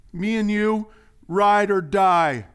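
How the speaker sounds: loud, high, drawn-out vow; hammed-up buddy oath, slowing at the end; mock devoted pledge. Friend swearing loyalty to the preacher, big mock bravado. Quotes pledge once used on him.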